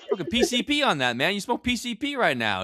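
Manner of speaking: high-pitched mock freak-out; Denzel Washington. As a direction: act scandalized, pitch way up. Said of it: "he's just freaking the fuck out".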